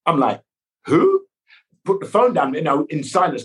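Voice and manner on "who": high, loud squeak of disbelief; stunned squeak on hearing the name; startled who. Retold or imitated. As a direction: yelp it in total shock.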